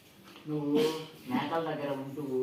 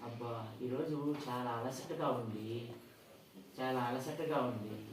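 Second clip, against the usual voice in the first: tired; 5 dB quieter, slower than usual. Acted. Tired farmer sighs at work.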